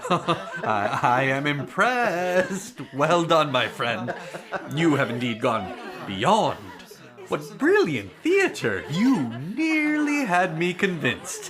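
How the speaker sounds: chuckling through the words; amused admiration; laughing praise. Acted. Prince praising friend's theater, laughing.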